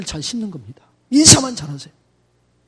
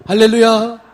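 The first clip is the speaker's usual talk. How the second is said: loud hallelujah; 5 dB louder, slower than usual. Shown as a demonstration. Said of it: "자, 시작".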